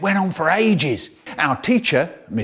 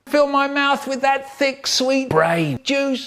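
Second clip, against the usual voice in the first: very high, held-up chant; hammed-up high hungry demand; high-voiced craving; 11 semitones higher than usual. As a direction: plead greedily, high and sustained. Acted greedy plea in story.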